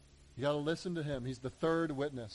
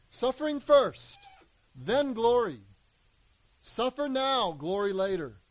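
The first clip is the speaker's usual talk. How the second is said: emphatic preaching; 6 semitones higher, 5 dB louder than usual. Real, not acted. Preacher drives home the point.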